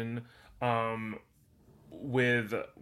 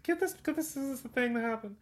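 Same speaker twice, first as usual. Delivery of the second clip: much higher, quoted confusion; mock excited quote; 11 semitones higher than usual. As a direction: squeak it out like puzzled viewers. Quoting themselves while watching.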